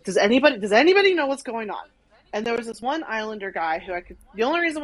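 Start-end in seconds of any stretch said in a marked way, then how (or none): 0.0-1.8 higher, louder plea to anyone around; re-enacted panicked appeal; frantic calling out. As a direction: call out urgently to a crowd. Acted frantic asking around stadium.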